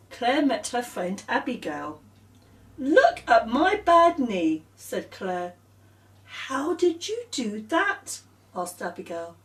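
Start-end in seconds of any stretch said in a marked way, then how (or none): 2.8-4.6 put-on slow, high and louder child voice; whiny little girl showing her knee; Claire's voice. Girl showing her friend her bad knee, slow and whiny. 6.3-8.2 slow, high, curious friend; Abigail's voice. Acted. Friend asking Claire about her knee, high and curious.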